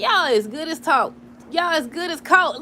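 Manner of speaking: loud and slow with a laugh in it; emphatic. Shown as a demonstration. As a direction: lay it down slowly and firmly. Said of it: "I'm stuttering and stuff".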